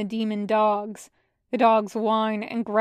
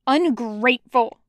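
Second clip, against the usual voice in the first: snapping; 5 dB louder than usual. Acted. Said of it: "Jiang Cheng snaps".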